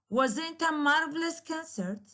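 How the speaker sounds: "wasn't a marvelous concert?" said high, falling at the end; exclamatory falling tune. Shown as a demonstration. Teacher gives a second exclamatory example, falling tune. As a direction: sound delighted and let it fall. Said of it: "there will be a falling instead of rising intonation".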